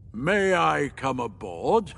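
slow, grand request ending in a chuckle; slow, ceremonious voice with chuckle; laughing Refusian. Acted. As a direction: boom it like an amused unseen being. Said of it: "unseen Refusian suddenly laughed".

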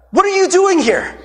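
high, loud startled question; surprised. Retold or imitated. Pastor startled to run into his friend at the mall, surprised high loud question. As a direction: blurt it out in shock at seeing him.

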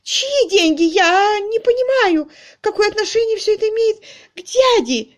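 very high, loud, distraught lady; frantic. A narrator's reading. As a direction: wail in panicked confusion. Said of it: "хватая ртом воздух".

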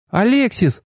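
high female-character call; interrupting goddess. A narrator's reading. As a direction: cut in on the singer, pitched high. Goddess tries to interrupt the singing. (высокий женский оклик)

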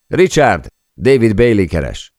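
high, loud call across the office. A narrator's reading. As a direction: shout over that someone is on the phone. Tony calls across again. (hangosan átkiáltva)

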